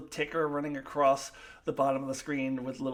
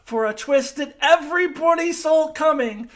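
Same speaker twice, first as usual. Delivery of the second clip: loud, higher, exasperated; angry rant; 9 semitones higher, 9 dB louder than usual. Real, not acted. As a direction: vent hard at the writers. Angry rant about twist.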